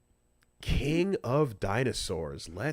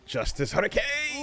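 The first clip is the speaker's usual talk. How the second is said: excited callout; 12 semitones higher than usual. Real, not acted. Calls out the move hyped.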